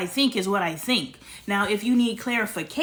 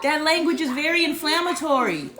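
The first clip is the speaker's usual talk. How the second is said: higher and louder, indignant; heated scolding; 6 semitones higher, 6 dB louder than usual. Real, not acted. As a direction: scold her for the insult. Heated argument with daughter.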